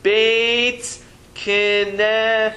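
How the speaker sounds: "Beit Knesset" drawn out very slowly, each syllable held apart; slow model reading. Shown as a demonstration. Teacher slowly models "Beit Knesset" for the class, very drawn out. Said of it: "what is that word again?".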